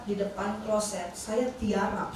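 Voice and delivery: usual speaking voice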